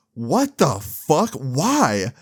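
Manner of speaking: retold slow, baffled outburst; mock disbelief. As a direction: act out stunned bafflement, stretching the words. Retold stunned reaction to confession.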